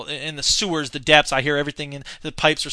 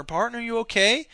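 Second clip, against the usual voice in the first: a hint of worried Charlie, a bit higher; lightly voiced concerned neighbour; 7 semitones higher than usual. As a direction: ask it with mild mock concern. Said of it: "He goes over".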